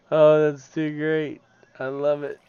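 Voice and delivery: loud, high, amused delight. Real, not acted. Streamer delighted watching fish fly up. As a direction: let the joy spill over, slowly.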